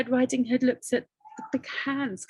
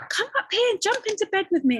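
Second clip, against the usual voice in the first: much higher, coaxing tone; the wolf luring the girl into bed; sweet wolf invite; 12 semitones higher, 3 dB louder, faster than usual. Acted.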